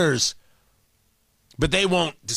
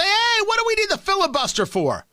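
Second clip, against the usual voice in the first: mock Schumer; 12 semitones higher than usual. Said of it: "Schumer is going to say".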